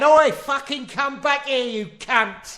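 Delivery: high, drawn-out Cockney yell; Cockney shark. Acted. Shark chasing a fleeing swimmer, Cockney shark voice. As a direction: holler like a pursuing Cockney shark. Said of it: "It's a Cockney shark".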